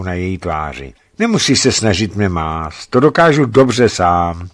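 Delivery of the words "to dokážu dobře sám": ordinary delivery, nothing marked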